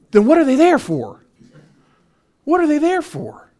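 exasperated, what is the point of them; incredulous retort. Retold or imitated. Railroad worker baffled at coworkers, high incredulous voice.

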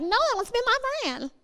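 high whiny plea; mock needy teen. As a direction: play the clingy teen. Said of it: "and you're like".

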